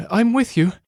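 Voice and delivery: high voice of a loyal little companion; rabbit girl. Acted. Rabbit girl's reply to narrator.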